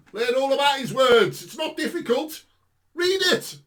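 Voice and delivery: high, loud, fiery preaching; preaching at full force. Acted. Preacher urging passersby to read the Bible, fiery and loud. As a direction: urge them on at the top of your voice.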